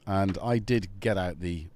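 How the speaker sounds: normal talking voice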